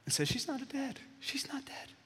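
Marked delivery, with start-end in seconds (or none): 0.3-1.0 "She's not dead" said high and hushed, softer; hushed reassurance. Acted. Jesus speaks softly to the parents. 1.2-1.9 "She's not dead" fully whispered, high and breathy. Acted. Jesus repeats it as a whisper.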